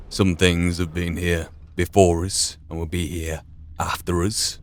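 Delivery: ordinary talk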